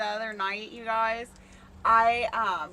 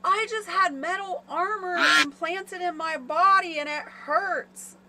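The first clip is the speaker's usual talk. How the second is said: sleep-talking husband; 7 semitones higher than usual. Retold or imitated. Wife retelling her husband's sleep talk about armor, higher and whiny. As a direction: play the husband mumbling a strange dream, pitched up and pained. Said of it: "He's like".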